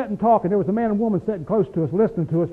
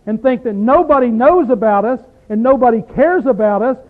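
loud, raised preaching voice; forceful preaching; 4 semitones higher, 7 dB louder than usual. Acted. Preacher exhorting a small congregation, loud and driving. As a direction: preach it out to the room.

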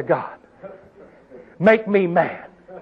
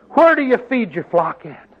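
usual speaking voice

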